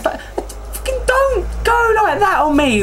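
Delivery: high, slow, indignant objection; exasperated protest. Real, not acted. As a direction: protest at your co-host, drawn out and high. Quarrel over refusing the accent.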